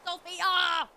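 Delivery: loud happy call. Real, not acted. Greeting Sophia in game, loud call. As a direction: call her name across the square. Calling out to character.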